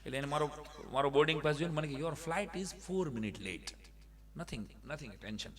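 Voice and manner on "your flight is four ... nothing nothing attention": slow, soft imitation of airport staff; slow quiet put-on clerk English; slow mock staff English. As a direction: stretch each word like a foreign official.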